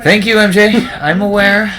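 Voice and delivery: sarcastic thanks. Real, not acted. Teased by friend for losing life.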